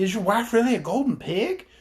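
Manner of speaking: put-on high, loud disbelieving girl's question; astonished Dorothy. A narrator's reading. Dorothy asks the chief about his wife, high and astonished. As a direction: ask in wide-eyed disbelief. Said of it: "cried Dorothy".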